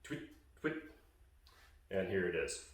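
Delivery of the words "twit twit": breathy unvoiced "Twit, twit" high and soft; just air on "Twit, twit"; a soft airy "twit" like into a goose call; whispered twit. Shown as a demonstration. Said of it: "it's just the wit pretty much".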